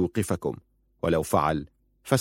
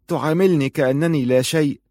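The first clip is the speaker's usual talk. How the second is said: higher, lightly acted woman's reproach; hurt accusation; 7 semitones higher, 3 dB louder than usual. Rashida confronts her cousin over his coldness, hurt and accusing. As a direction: reproach him in a lifted voice.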